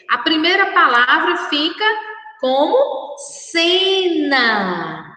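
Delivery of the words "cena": "cena" with both syllables held long; drawn-out syllables. Shown as a demonstration. Teacher giving children the first word, drawn out by syllable. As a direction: stretch "cena" slowly, syllable by syllable.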